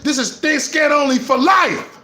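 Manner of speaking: loud, high proud shout; shouted boast of toughness; fired-up shout. Real, not acted. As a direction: holler it like a battle cry. Fired-up rant, repeated calmly after.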